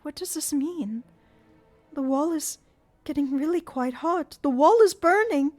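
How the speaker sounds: high, rising panic about the burning wall; frightened Christine. Acted. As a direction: cry out in alarm. Christine alarmed.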